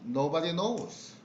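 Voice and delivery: ordinary delivery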